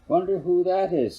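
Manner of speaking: a hint of curious dad; lightly voiced father. Father wonders aloud.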